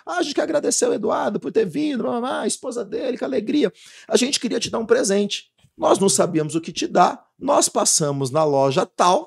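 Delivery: high, lively imitation of the hosts' thank-you speech; mock gushing thanks. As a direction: play the sweet emcee thanking the preacher. Organizers thank him on stage.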